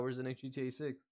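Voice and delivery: ordinary delivery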